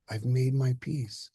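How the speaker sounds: slow, settled, at peace; calm and serene. Acted. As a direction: say it slowly like it is resolved. Father calmly states his peace.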